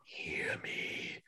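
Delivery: slow, low whispered vampire confession; a hushed, menacing monster speaking to Mina; Dracula whisper. Retold or imitated. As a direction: breathe it like Gary Oldman's Dracula. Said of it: "and he's like".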